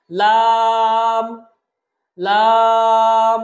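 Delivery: লাম loud with a long level vowel; four-alif stretch. Shown as a demonstration. Teacher models for repetition, লাম drawn out strongly. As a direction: hold the middle of লাম very long. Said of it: "আমরা চার আলিফ টানবো".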